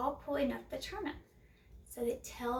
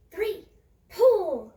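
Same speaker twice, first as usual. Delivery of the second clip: much louder count, laughing into the pull; big pull call; 5 semitones higher, 11 dB louder than usual. A narrator's reading. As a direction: call the final heave with a laugh.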